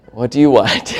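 low, soft mock-suspicious parent; wary parent. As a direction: answer flattery with quiet suspicion. Suspicious parent answering flattery.

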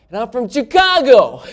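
Chicago blurted loud and high, laughing. Retold or imitated. Stranger John blurting where he's from, loud and high. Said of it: "when he gets to the place where he's from".

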